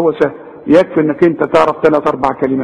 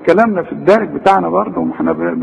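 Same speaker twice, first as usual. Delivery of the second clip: ordinary delivery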